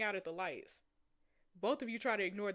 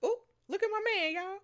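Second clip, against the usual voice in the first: much higher, louder and fast; excited squeal; 12 semitones higher, 5 dB louder than usual. Real, not acted. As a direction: gush over him in a squeal. Gushing over the love interest.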